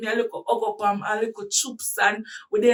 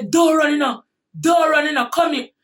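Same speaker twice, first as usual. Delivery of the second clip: louder firm command; retold angry call; 4 semitones higher, 5 dB louder than usual. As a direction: bark it after her, commanding. Angry boyfriend calls her back.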